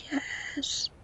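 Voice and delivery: very soft, hissy whisper; whispered yes. Real, not acted. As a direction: whisper it to yourself. Answering own question.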